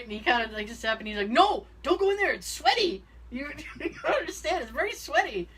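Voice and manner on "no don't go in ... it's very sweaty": put-on panicky kid, higher. Kid panicking to keep brother out.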